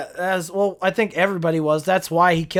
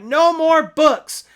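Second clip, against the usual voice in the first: higher, louder and slow with weight on "No"; emphatic line; 7 semitones higher, 7 dB louder, much slower than usual. Retold or imitated. Quoting the actor's trailer line with loud slow emphasis. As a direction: deliver it like a big dramatic outburst. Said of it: "when he throws the book and he's like".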